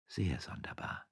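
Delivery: whispered, barely voiced; hushed agreement. Wife agrees with her husband, whispering. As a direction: confirm it under your breath. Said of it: "bestätigte Frau Frasquita".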